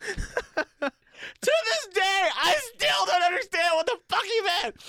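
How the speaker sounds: high, exasperated cry through amusement; squealed, incredulous exclamation; baffled outburst. Real, not acted. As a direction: burst out high in total bewilderment. Bursts out, baffled, after big laugh.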